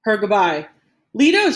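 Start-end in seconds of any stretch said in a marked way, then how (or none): 1.1-1.5 high, loud call of a name; anguished cry. A narrator's reading. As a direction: cry out after someone being left behind. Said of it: "she cried again".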